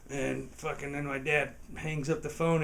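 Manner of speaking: usual speaking voice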